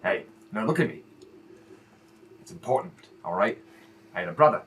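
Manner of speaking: low, soft heartfelt advice; earnest fatherly talk. Acted. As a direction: speak low and sincere to your son. Father speaks earnestly about brother.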